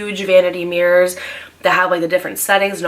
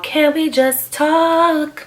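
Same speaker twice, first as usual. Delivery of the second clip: high and very slow, drawn-out pleading; whiny pleading ex; 9 semitones higher, much slower than usual. Retold or imitated. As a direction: stretch it out like a needy ex begging. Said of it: "leaving me voicemails".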